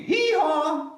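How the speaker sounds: high, loud braying hee-haw; donkey bray. Acted. Reading to children, loud high donkey hee-haw.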